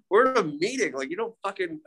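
high, exasperated retold rant; spitting out his frustration at the man sharing at the meeting; exasperated outburst. In the meeting, fed up with talk of Moses, exasperated outburst. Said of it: "So I'm getting mad".

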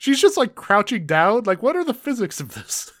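high-pitched, baffled protest; incredulous outburst. Real, not acted. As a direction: burst out in disbelief, voice jumping up high. Baffled outburst at absurd plot twist.

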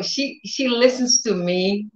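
normal talking voice

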